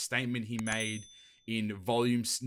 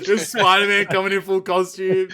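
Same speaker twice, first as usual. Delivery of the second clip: loud, high, cracking up; laughing; 7 semitones higher, 12 dB louder than usual. Real, not acted. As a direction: talk through helpless laughter. Cracking up at friend's joke.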